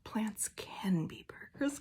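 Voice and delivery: soft unvoiced whisper, slow; slow inner-voice whisper; whispered thought. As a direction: whisper the private rebuttal to yourself. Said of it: "I thought to myself".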